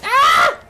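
high, loud shriek; startled scream. Real, not acted. Player caught by zombies, startled shriek. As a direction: shriek in sudden fright.

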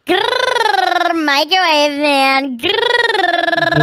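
growling. Acted. Sister growls at her brother.

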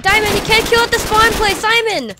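high, loud, exasperated yelling; angry shouting. Real, not acted. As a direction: yell at him to stop. Teammate kills at spawn point.

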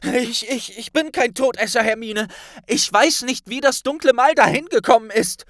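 high, loud, fast and pleading; frightened boy insisting he is innocent; desperate Harry. A narrator's reading. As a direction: stammer it out in despair. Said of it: "stöhnte Harry verzweifelt".

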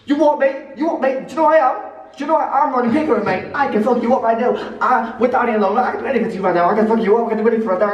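high-pitched, hammed-up tough-guy challenge; mock aggressive confrontation. Vlogger squaring up to his mate in the tunnel, mock aggressive. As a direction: play at picking a fight, worked up and high. Acts a threatening street confrontation.

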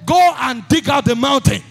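loud, high, driving sermon voice; preaching at full force. Acted. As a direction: hammer each line at the crowd.

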